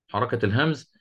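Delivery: ordinary talk, nothing marked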